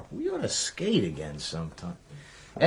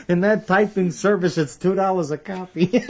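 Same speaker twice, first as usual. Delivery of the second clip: laughing, higher and louder mock father voice; imitating his father; 10 semitones higher, 6 dB louder than usual. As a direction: ham up your father's line through a laugh. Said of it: "My father used to say".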